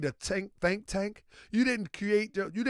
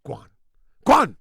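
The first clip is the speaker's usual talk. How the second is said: put-on Jamaican accent, rising to a shout; 5 semitones lower, 9 dB louder, faster than usual. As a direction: shoo them off in mock Jamaican. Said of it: "That was Jamaican accent".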